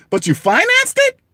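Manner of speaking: squeaky high mock disbelief; incredulous retort. Retorting to people who financed phones, mock disbelief. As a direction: jump way up in sarcastic disbelief.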